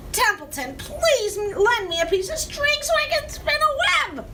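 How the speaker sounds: high, whining rat voice in mock complaint; disgusted rat. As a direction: list every chore like a put-upon rat. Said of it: "he said in disgust".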